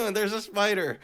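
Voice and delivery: high, shaky laughing through the words; giggling in relief after the spider scare; nervous laughter. Real, not acted. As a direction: laugh off the fright.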